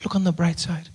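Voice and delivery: very soft, gentle hushed voice; quietly calm a crying son; soothing mother. Retold or imitated. Injured mom comforting her son, soft and soothing. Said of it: "And she says".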